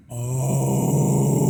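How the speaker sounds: rattling voice cracking into air; vibrating false-chord distortion. Shown as a demonstration. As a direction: sound like talking through a fan. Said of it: "when that voice is out".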